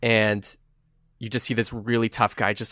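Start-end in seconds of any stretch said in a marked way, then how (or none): none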